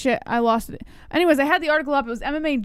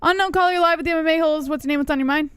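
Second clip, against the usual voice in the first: radio announcer; 5 semitones higher, 3 dB louder, faster than usual. Acted. Radio host announcing caller.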